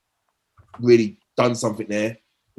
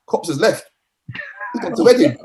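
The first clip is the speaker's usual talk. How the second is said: rising higher and louder to the reveal; excited teammate; 9 semitones higher, 4 dB louder than usual. Retold or imitated. Teammate revealing the manager left, building higher and louder. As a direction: land the news like a bombshell. Teammate breaking surprising news.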